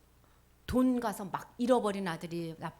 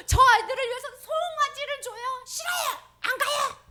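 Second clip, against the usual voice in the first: high, loud, tearful complaint; elder son protesting to father like a sulking child; whiny yelling; 13 semitones higher, 12 dB louder than usual. Acted. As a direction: wail your grievance at dad.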